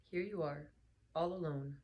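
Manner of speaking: ordinary delivery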